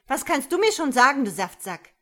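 fast, high, loud retort; scornful snapping. A narrator's reading. Woman cutting the man off, angry and scornful. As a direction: fire the insult back at him.

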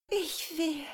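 very high, faltering start of a plea; desperate pleading. A narrator's reading. Woman forced to say her wish, desperately pleading. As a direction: struggle to get the wish out. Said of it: "flehte sie verzweifelt".

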